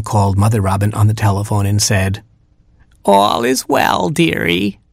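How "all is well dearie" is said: high, slow granny voice; reassuring Grandma. Acted. Grandma phones Mother Robin, high reassuring voice. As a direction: soothe in a high singsong granny voice.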